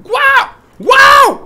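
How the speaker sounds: grand cri aigu émerveillé; cri de surprise. Real, not acted. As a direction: hurle d'étonnement. Réaction de surprise en jeu. (cri de surprise)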